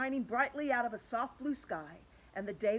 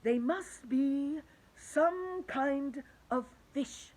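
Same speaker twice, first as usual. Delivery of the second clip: put-on old grasshopper; 5 semitones higher, 3 dB louder, much slower than usual. A narrator's reading. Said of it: "said the old green grasshopper".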